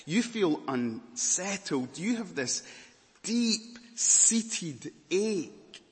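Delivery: usual speaking voice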